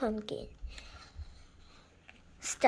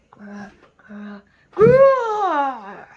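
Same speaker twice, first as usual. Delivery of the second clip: growling; 15 dB louder, much slower than usual. A narrator's reading. Monster growl in the story, building to loud. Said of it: "The growling got louder".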